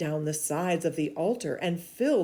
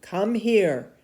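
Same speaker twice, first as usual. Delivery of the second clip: calling out; 4 semitones higher, 7 dB louder than usual. Acted. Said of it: "Elijah called to the people".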